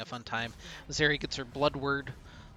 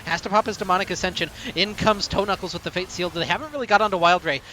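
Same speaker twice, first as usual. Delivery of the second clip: louder and higher as the fight builds; hype play-by-play; 5 semitones higher, 11 dB louder than usual. Acted. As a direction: build to a shouting call of the teamfight.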